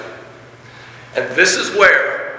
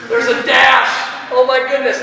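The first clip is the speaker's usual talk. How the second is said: high, loud burst of delight; squealing with delight at punctuation; excited grammar nerd; 9 semitones higher, 6 dB louder, slower than usual. Shown as a demonstration. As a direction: gush over the dash like a thrilled nerd. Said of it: "I get all excited and I would say".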